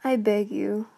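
ordinary delivery

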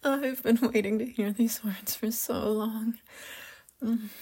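giddy breathy laugh into whisper; excited whisper. Acted. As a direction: laugh then whisper with joy. Joyful whisper after proposal.

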